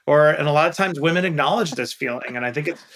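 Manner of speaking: usual speaking voice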